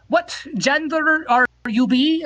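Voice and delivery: high, slow put-on Norwegian accent; mock Norwegian accent. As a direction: ask it like the Norwegian stranger, drawn out. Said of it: "this accent is".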